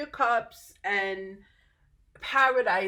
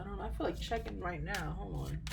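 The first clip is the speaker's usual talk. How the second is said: muttered; 9 dB quieter than usual. Real, not acted. Muttering to self.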